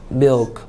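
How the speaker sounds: "milk" as one single syllable; English "milk", no vowel after the k. Shown as a demonstration. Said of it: "이름절이죠?".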